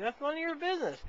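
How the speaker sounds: high-pitched retold protest; indignant retort. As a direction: act out refusing a second time, voice jumping up. Repeated refusal, acted as more indignant.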